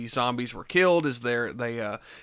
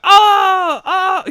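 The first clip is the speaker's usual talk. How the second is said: high shrieking mock scream; impression of an actor's panicked scream; imitated scream; 13 dB louder than usual. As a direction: belt out a comic high-pitched wail. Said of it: "the way he's just like".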